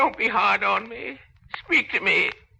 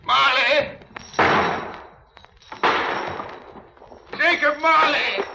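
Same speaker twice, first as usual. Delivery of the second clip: calling out; 4 semitones higher than usual. Acted. Scrooge calls after vanished ghost.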